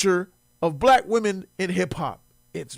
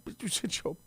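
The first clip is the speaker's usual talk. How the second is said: ordinary talk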